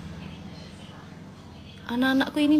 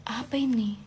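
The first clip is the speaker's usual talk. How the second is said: whisper; 5 dB quieter than usual. Real, not acted. Reading a comment.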